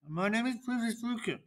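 rushed, soft mumble under the breath; fast, barely audible mumble; mumbled too fast. Shown as a demonstration. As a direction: swallow the words quickly and quietly. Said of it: "we cannot hear you".

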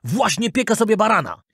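understated angry outburst; mild mock yell. A narrator's reading. Director threatening puppet, mild mock yell. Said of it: "wrzasnął dyrektor".

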